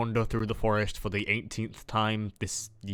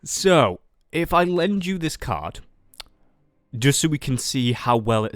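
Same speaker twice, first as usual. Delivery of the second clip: excited pitch; 4 semitones higher, 6 dB louder than usual. Real, not acted. Eager pitch to the bird watcher.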